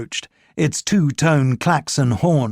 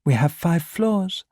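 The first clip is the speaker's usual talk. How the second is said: higher, slow French saleswoman voice; slow, higher and helpful; 5 semitones higher than usual. A narrator's reading. Saleswoman telling Ricard about the lift, slow and higher.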